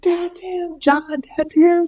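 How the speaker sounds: high-pitched mock astonishment, drawn out; imitated amazed wife. Imitating wife recognizing the healer.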